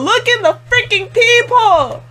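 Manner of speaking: real frustrated shouting at the show; yelling at the screen. Real, not acted. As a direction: urge the character, exasperated.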